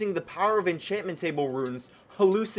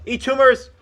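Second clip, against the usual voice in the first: battle cry; 6 semitones higher, 7 dB louder than usual. Real, not acted. Firing tumor weapon again.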